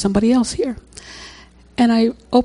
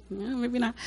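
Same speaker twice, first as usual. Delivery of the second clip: hushed; 3 semitones higher, 14 dB quieter than usual. Retold or imitated. Doubting her own guess.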